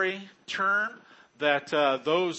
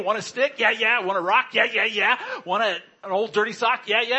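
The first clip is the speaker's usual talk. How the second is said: eager, bouncy dog voice, pitched up; excited dog; 5 semitones higher, 4 dB louder, faster than usual. Pretend. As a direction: play a dog thrilled by anything.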